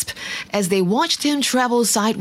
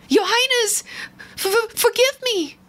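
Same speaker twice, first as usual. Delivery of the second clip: much higher, stammering in fright. A narrator's reading. Grasshopper stammers his apology to the king, much higher and frightened. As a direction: stutter out a frightened, high apology. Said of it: "he stammered".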